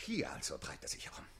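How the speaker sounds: soft seething whisper; quiet menacing whisper. Acted. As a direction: hiss it under your breath. Count muses, seething.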